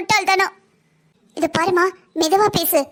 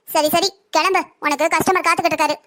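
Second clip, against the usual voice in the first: usual speaking voice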